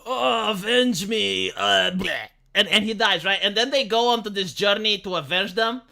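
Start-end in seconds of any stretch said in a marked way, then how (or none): 0.1-2.3 put-on dying old master. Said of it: "with his last dying breath".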